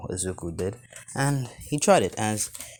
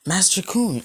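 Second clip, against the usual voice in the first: yells out; 9 semitones higher than usual. Acted. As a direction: call the name out brightly. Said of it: "yells out".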